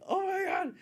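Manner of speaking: gushing high-pitched reenactment; excited outburst. Retold or imitated. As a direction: squeal it, overwhelmed with delight. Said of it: "I felt like this".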